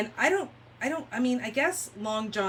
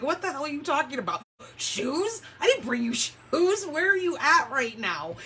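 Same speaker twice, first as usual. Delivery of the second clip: baffled retort; 6 semitones higher than usual. Retold or imitated. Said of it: "I'm like".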